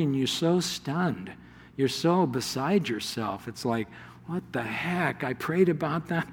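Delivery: almost breathless amazement; quiet stunned murmur; hushed awe. Retold or imitated. Stunned by a miracle.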